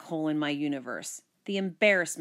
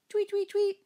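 very high, birdlike tweeting; squeaky high teasing chirp. Acted. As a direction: tweet mockingly at your sister. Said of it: "he teased".

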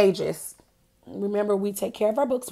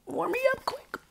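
shivering; 13 semitones higher, 8 dB quieter, faster than usual. Acted. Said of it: "See that?".

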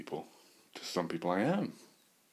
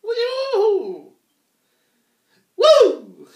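high, loud "woo" holler; wild high-pitched whooping; 15 dB louder than usual. Real, not acted. Whooping loudly to the empty air.

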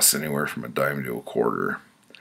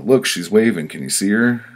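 put-on eager kid voice; 7 semitones higher, 9 dB louder than usual. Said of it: "I'd wave out the window".

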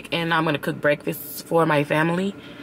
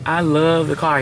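ordinary talk

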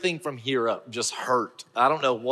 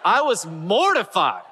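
high-pitched, laughing outburst of embarrassment; mortified laughter; 11 semitones higher, 4 dB louder, slower than usual. Real, not acted. Man confessing his awful slip to listeners, laughing in mortification. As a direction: squeal it out through laughter, stretching the words.

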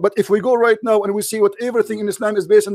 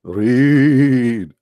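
angel commanding; 8 semitones lower than usual. Acted. Angel commands Muhammad to read.

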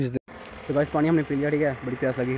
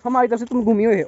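higher-pitched retort; accusing; 8 semitones higher, 3 dB louder than usual. Real, not acted. Vlogger telling companion she wandered off, accusing. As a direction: argue back in a raised pitch.

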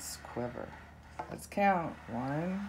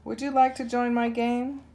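higher, fast, eager cub calling out; louder roar; 6 semitones higher, 4 dB louder, faster than usual. Acted. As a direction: rush the invitation like a hopeful cub. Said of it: "He roars even louder".

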